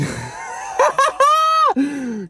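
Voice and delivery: a shrieking laugh at her own joke. Real, not acted.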